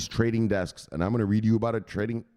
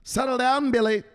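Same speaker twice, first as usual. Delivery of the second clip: high, raised voice telling him to settle; firm calming reply; 13 semitones higher, 4 dB louder than usual. Retold or imitated. Johnny telling his brother to settle down, high insistent voice. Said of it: "you gotta tell that motherfucker".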